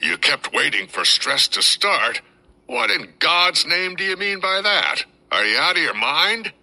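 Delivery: high, incredulous shouted disbelief; a furious officer raising his pitch; enraged colonel. A narrator's reading. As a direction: hurl it back at him, outraged. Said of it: "His face was so swollen with rage".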